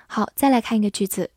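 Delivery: normal talking voice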